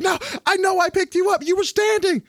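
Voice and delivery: high-pitched imitation of baffled teammate; bewildered protest. Teammates insisting they revived him, bewildered protest. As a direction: insist in a high, disbelieving squeal.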